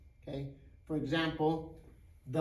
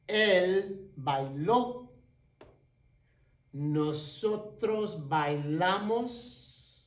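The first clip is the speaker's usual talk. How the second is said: slow clear drill; 4 dB louder, slower than usual. Shown as a demonstration. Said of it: "All you do is drop off the A R".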